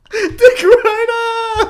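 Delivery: very high, loud, drawn-out holler; stretched high-pitched jeer; mock heckling shout. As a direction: yell it like a playful taunt.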